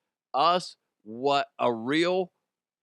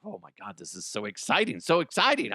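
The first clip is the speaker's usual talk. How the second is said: ordinary talk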